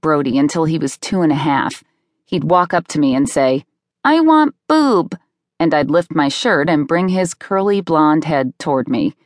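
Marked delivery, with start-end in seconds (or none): 4.0-5.2 put-on high, slow toddler voice; demanding toddler. A narrator's reading. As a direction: draw it out like a little kid's demand.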